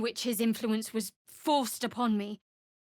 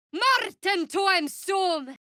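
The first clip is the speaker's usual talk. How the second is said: shouted incantation; 10 semitones higher, much slower than usual. Acted. Wizard casting a spell in battle, slow shouted incantation. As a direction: bellow the spell word by word.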